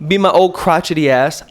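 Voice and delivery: usual speaking voice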